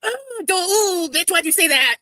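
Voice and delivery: high, loud scandalized gasp of words; shocked ooh. Real, not acted. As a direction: react like you can't believe it. Shocked by clip's comparison.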